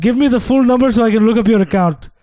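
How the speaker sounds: put-on call-center agent in a lightly done African accent; mock African accent, slightly high. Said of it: "I couldn't do my African accent".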